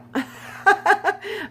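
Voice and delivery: loud, high-pitched burst of laughter; real high cackling laugh. Real, not acted. Laughs at starting to read unprepared.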